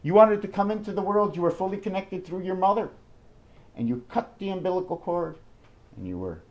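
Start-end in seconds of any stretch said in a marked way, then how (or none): none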